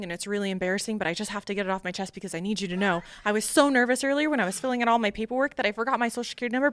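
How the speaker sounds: fast, rambling confession; nervous word vomit. Retold or imitated. Nervous recruit confessing to her interviewer, hurried word vomit. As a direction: blurt it all out in one anxious rush. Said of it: "word vomited on this guy".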